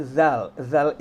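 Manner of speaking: "zal" like voiced th; emphatic dental "zal"; tongue between teeth. Shown as a demonstration. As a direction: buzz "zal" on a bitten tongue. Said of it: "lidahnya digigit juga".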